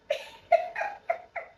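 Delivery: high-pitched squeaky laughter; real laugh at her own story. Real, not acted. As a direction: burst into high giggles. Laughs after her defiant quote.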